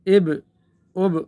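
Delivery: اب with the final ب bounced and echoed; qalqalah on ab. Shown as a demonstration. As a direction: pop the sakin ب of اب with an echo. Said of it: "جس طرح با میں اب". (اب میں با کا قلقلہ)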